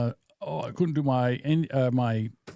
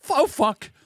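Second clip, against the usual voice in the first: higher-pitched mock "oh no" reaction; retold dismay; 10 semitones higher, 3 dB louder than usual. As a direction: act out suddenly hitting a hard word. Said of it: "I'm like".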